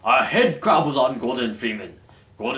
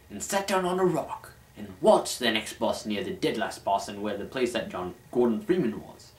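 ordinary delivery

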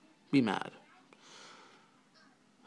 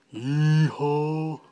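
long, stretched-out yee-haw, higher; exaggerated yee-haw; 4 semitones higher, 4 dB louder than usual. Shown as a demonstration. Vlogger mocking how it wouldn't be said, exaggerated yee-haw. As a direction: hold both syllables, hamming it up. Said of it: "You wouldn't say".

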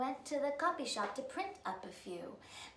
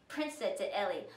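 usual speaking voice

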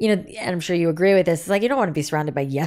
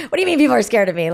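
fast, higher and louder, laughing through it; laughing disbelief; 5 semitones higher, 5 dB louder, faster than usual. Retold or imitated. Retelling brother's remark, laughing.

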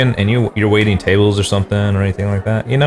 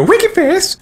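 very high squeaky voice; squeaky winky voice. Shown as a demonstration. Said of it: "Whenever I try to say winky face".

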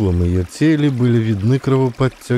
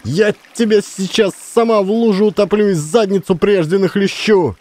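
1 furious tirade; 10 semitones higher than usual. A narrator's reading. Woman furiously berating the boy.

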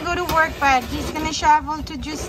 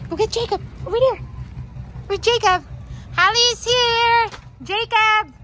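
calling out; 7 semitones higher than usual. Real, not acted. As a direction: call out to find Jacob. Calling for Jacob outside.

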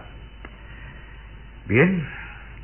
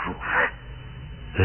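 nombre susurrado, muy suave; susurro; 7 dB quieter than usual. Acted. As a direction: susurrar su nombre con deseo. Declaración de amor del conde. (el conde declara su amor a Ruth en susurro)

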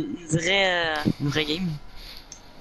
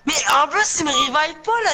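high, louder griping; raging gamer; 7 semitones higher, 3 dB louder, faster than usual. Real, not acted. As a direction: complain hotly, pitch up. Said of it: "pis il rage".

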